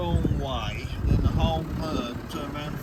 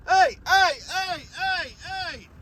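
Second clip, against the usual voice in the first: high repeated hey calls; calling out; 13 semitones higher, slower than usual. Real, not acted. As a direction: call out to break the fight. Calling cattle to stop.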